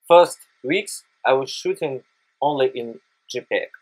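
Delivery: usual speaking voice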